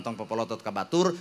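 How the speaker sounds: ordinary talk, nothing marked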